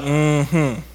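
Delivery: knowing, mock-skeptical hum; side-eye. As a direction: hum it like you caught him out. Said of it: "he cut him that side eye".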